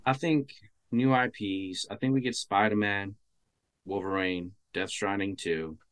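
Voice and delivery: distant, quiet voice off mic; speaking too far from the microphone; phone mic far away. Real, not acted. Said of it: "sounds like you're a little far from the mic".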